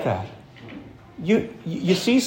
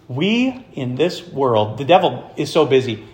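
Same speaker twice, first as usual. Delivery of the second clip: ordinary talk, nothing marked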